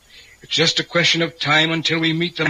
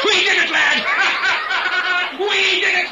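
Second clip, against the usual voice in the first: shrieking high through laughter; wild triumphant laughter. Acted. Ritual leader to lad as it works, hysterically triumphant. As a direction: crow deliriously, laughing. Manic triumph at apparent success.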